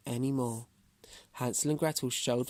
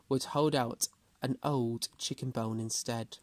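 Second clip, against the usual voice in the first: usual speaking voice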